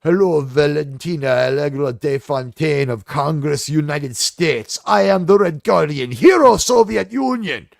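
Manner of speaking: pompous Soviet hero introducing himself; Red Guardian. Acted. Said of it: "Red Guardian would walk in and be like".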